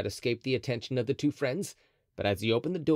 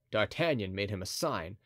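usual speaking voice

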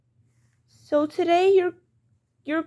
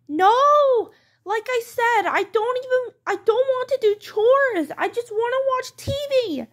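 high-pitched whiny refusal; stubborn child; 5 semitones higher than usual. Acted. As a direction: dig in like a sulky kid. Child refuses chores again.

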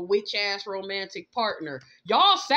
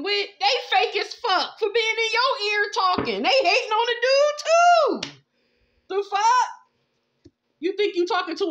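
heated rant; 11 semitones higher, 7 dB louder than usual. Real, not acted. Calling out the fake friend, high and loud outrage. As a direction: pitch shooting way up, loud, on the edge of crying out. Ranting at a meddling rival.

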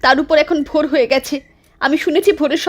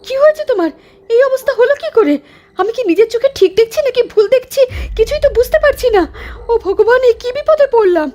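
shocked and rising into fear, higher; horrified at what she sees; 6 semitones higher than usual. Acted. Wife horrified at her husband's mangled face, panicked.

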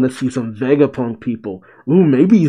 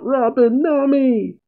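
pitched-up, held-back mock wail; mock yell; 12 semitones higher than usual. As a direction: call out in hammed-up desperation. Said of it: "Sanji yells out".

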